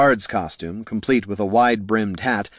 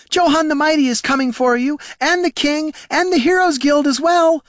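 high, furious shouted threat; hollering; 5 dB louder than usual. Acted. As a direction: yell vengeance after them, piling on names. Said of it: "she hollered after them".

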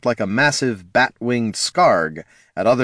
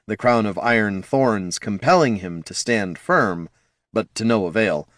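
plain reading voice

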